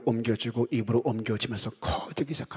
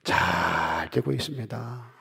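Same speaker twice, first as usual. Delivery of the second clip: congregational declaration; 4 semitones lower, slower than usual. Shown as a demonstration. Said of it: "인사합니다".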